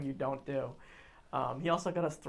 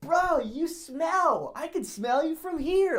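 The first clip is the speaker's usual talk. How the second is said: high, loud, stretched-out holler; cartoonish drunken taunt; 12 semitones higher, 6 dB louder, slower than usual. Drunk friend insulting a huge stranger, cartoonish holler. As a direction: draw it out like a cartoon heckler. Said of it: "something out of a cartoon".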